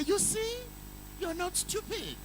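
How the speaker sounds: lightly voiced proud teacher; encouraging teacher. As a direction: reassure the pupil brightly.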